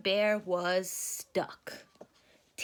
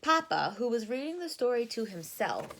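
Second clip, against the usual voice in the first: usual speaking voice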